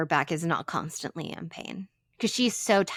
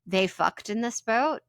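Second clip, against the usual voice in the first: ordinary delivery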